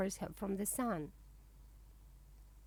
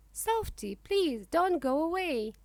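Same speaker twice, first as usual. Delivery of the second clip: higher, louder pleading call after Softy; 6 semitones higher, 4 dB louder than usual. A narrator's reading. Young ostrich begs Softy not to go, pleading call. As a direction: call her back, begging her not to leave. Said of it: "I cry".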